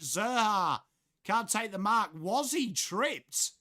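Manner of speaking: high, drawn-out footy commentary; live play-by-play. Real, not acted. Commentator calls Brayshaw and LDU's run, high play-by-play. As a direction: call the action with rising urgency, stretching the key words.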